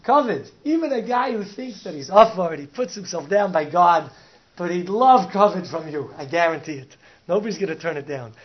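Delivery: loud, driving sermon voice; preacher pressing the lesson on kavod; preaching at full force. Acted. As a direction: hammer the point home to the crowd.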